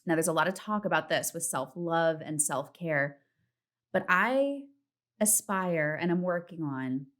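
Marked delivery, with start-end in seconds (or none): none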